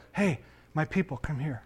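tender, quiet invitation; calling by new nickname. Acted. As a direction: gently call them over, voice dropped. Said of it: "You change their nickname".

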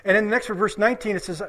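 ordinary talk

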